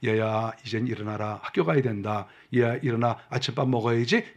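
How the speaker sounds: overflowing love, wake them softly; mother gently waking her child for breakfast; loving and tender. Shown as a demonstration. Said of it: "사랑이 넘쳐서".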